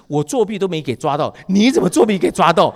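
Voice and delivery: furious father blasting his son; angry. Retold or imitated. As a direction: scold the son, rising to a shout. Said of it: "我一股子气".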